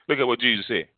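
ordinary talk, nothing marked

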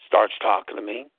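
ordinary talk, nothing marked

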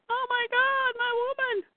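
high, soft mock scream. Voicing a hero whose woman is hurt, mock scream.

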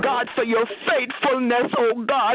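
rising, impassioned prayer voice building toward a climax; fervent prayer. Real, not acted. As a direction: church prayer leader pouring out thanks.

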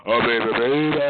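low rapper impression. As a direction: drop the voice into a rap-star imitation. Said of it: "You gonna sound like [name]".

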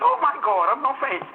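high, mock Haitian-accented swoon; Haitian old lady. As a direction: imitate an overwhelmed old Haitian woman. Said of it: "she could hardly speak English".

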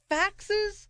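high incredulous Willy Wonka-style question; Gene Wilder. Retold or imitated. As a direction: squeak it out in disbelief. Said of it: "I can see him saying the word".

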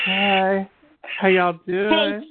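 high, slow, put-on drawly greeting; Chelsea Manning impression. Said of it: "you don't do a good Chelsea Manning".

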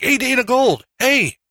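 high-pitched mock holler; yelling across the room. Friend hailing a comedian across a room, mock yelling. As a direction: call out as if hailing someone far off. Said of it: "like, yelling, like, across the room".

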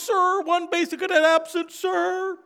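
cadet report. Retold or imitated. Woody reporting to sergeant.

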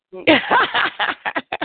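high, ringing cackle of amusement; high-pitched belly laugh; burst of laughter. Real, not acted. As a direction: crack up out loud. Cracks up at caller's greeting.

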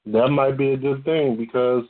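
normal talking voice

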